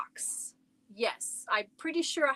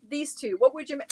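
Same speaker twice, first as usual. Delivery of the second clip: usual speaking voice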